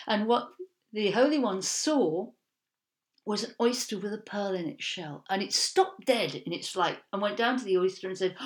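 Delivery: usual speaking voice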